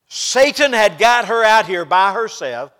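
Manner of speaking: loud, slow preacher's cry; forceful preaching. Real, not acted. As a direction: drive it out slowly at full force. Preaching outburst.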